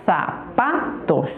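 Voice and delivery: "zapatos" slowly split into syllables; Silbentrennung. Shown as a demonstration. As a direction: break "zapatos" into za, pa, tos with small gaps. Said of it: "Primero separémosla".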